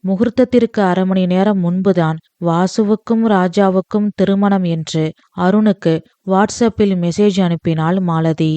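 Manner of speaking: usual speaking voice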